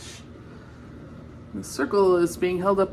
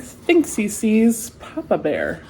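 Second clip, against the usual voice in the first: ordinary delivery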